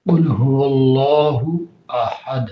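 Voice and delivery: slow, measured tajweed chant of "قل هو اللہ احد"; قرآنی تلاوت کا انداز; Quran recitation. Shown as a demonstration. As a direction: recite the verse slowly, each sound drawn out. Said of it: "سورہ اخلاص ہم پڑھتے ہیں".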